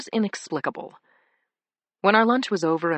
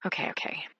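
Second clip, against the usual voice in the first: breathy whispered okay; whispering; 8 dB quieter than usual. A narrator's reading. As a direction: whisper it, giving in. Whispered resigned reply.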